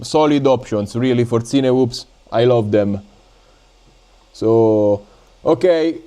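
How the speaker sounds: ordinary delivery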